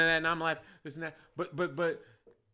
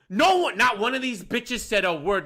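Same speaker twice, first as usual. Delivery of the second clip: yelling; 4 semitones higher than usual. Real, not acted. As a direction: shout it in outrage. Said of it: "I'm sorry for yelling".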